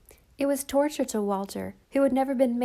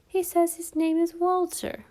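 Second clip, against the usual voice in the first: mocking; 8 semitones higher than usual. A narrator's reading. Children mock Walter's name.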